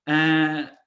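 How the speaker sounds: usual speaking voice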